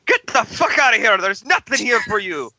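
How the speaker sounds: hammed-up angry priest, pitched high; acted angry priest. Priest confronts the intruder at the church, mock angry. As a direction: order the intruder out, drawing out the end.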